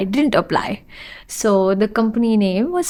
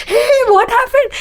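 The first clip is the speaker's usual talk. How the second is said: high, louder alarmed "Hey, what happened?"; 13 semitones higher, 4 dB louder than usual. Retold or imitated. Friend startled as she bursts into tears, high alarmed. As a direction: jump up high in sudden concern.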